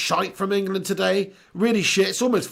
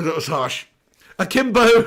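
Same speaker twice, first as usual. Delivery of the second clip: usual speaking voice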